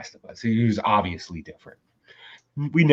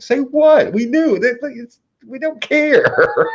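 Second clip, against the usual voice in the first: "Say what?" in a much higher, incredulous voice, slowing at the end; 3 dB louder, slower than usual. Retold or imitated. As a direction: play it as amused disbelief, almost laughing. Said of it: "And I was like".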